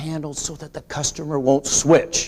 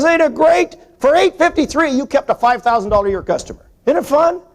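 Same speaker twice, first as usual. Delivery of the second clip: higher, louder, delighted; gleeful retold reply; 11 semitones higher, 6 dB louder than usual. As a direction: sell the joy of losing a few dollars. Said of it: "I says".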